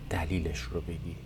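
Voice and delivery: soft whispered prompt; hushed aside to the class. Real, not acted. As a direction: drop to a breathy whisper. Teacher prompting students, whispered.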